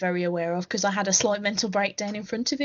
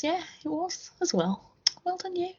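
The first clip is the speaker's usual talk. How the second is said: high, soft, surprised praise; unintentionally patronising; 8 semitones higher, 6 dB quieter than usual. Real, not acted. Praising co-host's trumpet tooting, sounding patronising. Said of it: "that sounded patronising".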